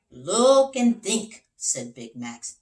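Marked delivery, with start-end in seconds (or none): 0.1-1.5 slow, louder and higher, weighty; wise detective advice. Acted. Big Max tells the king his secret, slow and louder. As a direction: impart the secret slowly.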